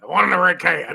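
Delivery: lightly voiced talking dog. Dog answering its owner about food, talking dog. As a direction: put on a hint of a dog character. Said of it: "And the dog's like".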